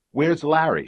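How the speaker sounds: high, urgent demand from a scared woman; breathless plea. Acted. Cornered wife to the detective, high and urgent. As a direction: gasp it out, desperate. Frightened woman caught in street.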